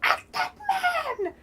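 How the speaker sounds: imitating a villain losing it; furious Kylo Ren. Fan imitating Kylo Ren ordering troops to fire, mock fury. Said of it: "he loses his shit".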